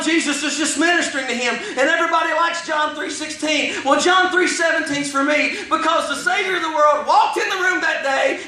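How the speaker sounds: high, driving sermon cadence; full-force preaching. Real, not acted. Preacher testifying how Jesus pursued him, full-force preaching. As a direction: preach it out with rising fervor, pitched high.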